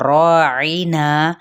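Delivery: راعینا very slow, with a long ra and a long na; slow tajweed demo. Shown as a demonstration. Teacher sounds out راعینا slowly, long ra and long na. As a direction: stretch both alifs of راعینا. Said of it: "را مد اصلی بالالف".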